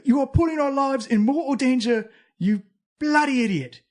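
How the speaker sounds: loud, high, agitated telling-off; scolding overheard voice. Acted. Game master as voice heard under the door, angry scolding. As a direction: berate them in a loud put-on voice. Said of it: "that voice going like".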